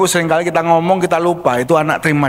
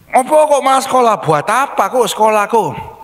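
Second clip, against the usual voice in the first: put-on higher, quick child's protest; a kid pushing back at the adult; defiant child; 6 semitones higher than usual. Anak membantah. (suara anak yang membantah, tinggi)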